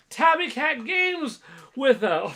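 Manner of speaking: loud, high, drawn-out announcement; excited shout-out read. Real, not acted. Streamer announcing Tabby's gifted membership, excited yelled read. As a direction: belt out the name slowly like a ring announcer. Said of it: "a read like that".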